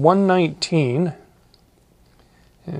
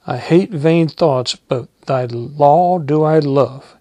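steady reading at normal pace; just read the verse through again; plain pulpit reading; scripture reading; 4 dB louder than usual. Acted.

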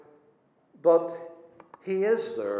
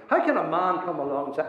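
usual speaking voice

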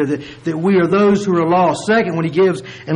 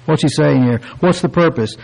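ordinary talk, nothing marked

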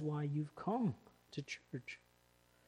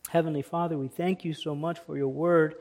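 prayer; 7 dB louder than usual. Acted. Opens prayer.

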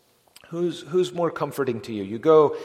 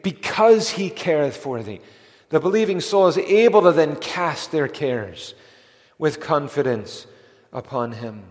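louder, higher emphatic restatement; fervent preaching; 3 semitones higher, 6 dB louder than usual. Real, not acted. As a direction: drive the promise home.

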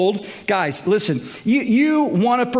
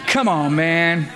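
slower, lightly voiced presidential come-on-man; commander-in-chief impression; 4 semitones lower than usual. Preacher repeats the catchphrase as a mock president impression. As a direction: drag it out like the commander-in-chief, half-joking. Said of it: "I just sounded like our commander-in-chief".

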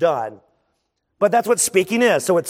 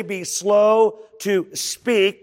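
slow on slow; much slower than usual. Shown as a demonstration. Preacher stretches the word slow.

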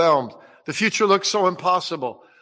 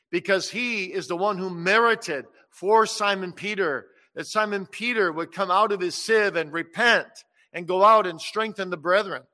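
usual speaking voice

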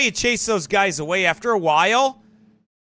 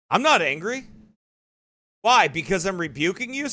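ordinary delivery

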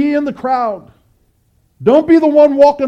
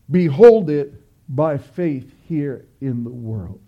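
slower, lower, reading the quote back; 8 semitones lower, slower than usual. Shown as a demonstration. Preacher closing the quote for the congregation, slow and low. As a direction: lay the words down slowly and low. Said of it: "Let me say that again".

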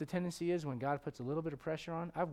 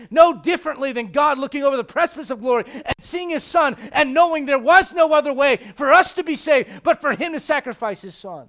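higher, louder, driving sermon voice; full force preaching; 9 semitones higher, 16 dB louder than usual. Acted. Pastor pressing the church about the altar, full force preaching. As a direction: preach it out to the back rows.

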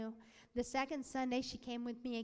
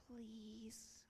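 whispered, pleading please; hushed plea; 15 dB quieter than usual. Retold or imitated. Preacher voices people begging Jesus, hushed pleading. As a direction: beg under your breath. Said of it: "They pleaded".

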